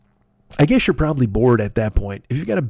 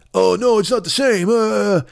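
dismissive mock; 6 semitones higher, slower than usual. Mocking liberal critic's dismissal.

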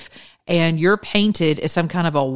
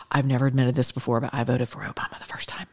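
whispered, hurried confession; secret confession; 4 semitones lower, 6 dB quieter, faster than usual. Retold or imitated. A viewer's email confessing an Obama vote, hushed and hurried. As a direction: lean in and whisper it like a guilty secret. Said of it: "secretly come to me in emails".

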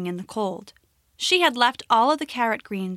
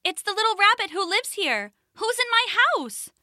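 little rabbit voice; 10 semitones higher than usual. Acted. Rabbit calling into her cave.

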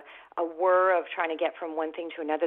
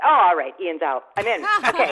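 eager switch; 7 dB louder than usual. Retold or imitated. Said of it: "And I went".

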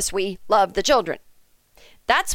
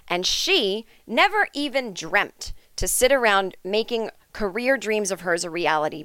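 normal talking voice

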